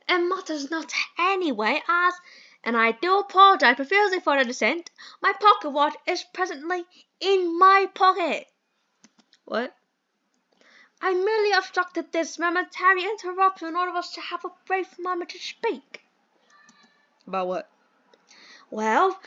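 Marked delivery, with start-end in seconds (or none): 0.1-8.5 posh British gentleman. Acted. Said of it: "I hate this accent". 11.0-16.0 a slightly higher, lightly put-on posh English accent; posh British gentleman. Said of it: "I hate this accent".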